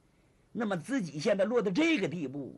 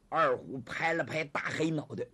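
usual speaking voice

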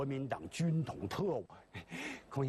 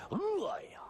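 hungry wail; 12 semitones higher than usual. Acted. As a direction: cry out, starving and alone. Hungry fugitive cries out.

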